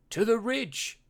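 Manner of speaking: high, grand walrus proclamation; proclaiming. A narrator's reading. Walrus proclaiming to the pup, proud and grand. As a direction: announce it proudly. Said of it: "he proclaimed with his chin".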